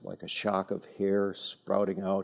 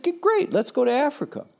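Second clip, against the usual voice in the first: high-pitched, louder, eager agreement; boyish eagerness; 4 dB louder than usual. Retold or imitated. Boy answering his mom about moving to Africa, eager. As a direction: squeal it like a thrilled kid.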